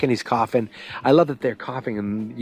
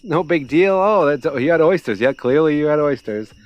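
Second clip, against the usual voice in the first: cheerful pros; 4 semitones higher, 3 dB louder than usual. Retold or imitated. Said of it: "They're like".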